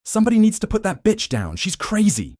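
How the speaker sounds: loud, high contemptuous shout; yelling. Acted. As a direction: yell it across the cells. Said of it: "Troy yells".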